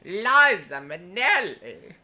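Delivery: high, loud, slow showbiz voice; [name]. Retold or imitated. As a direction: belt the name out like a diva. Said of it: "Scarlett Bobo was Liza with a Z".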